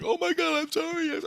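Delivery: very high-pitched imitation of an apologetic woman; mimicked frantic apology. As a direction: play her flustered apology way up high. Retold spirit apologising.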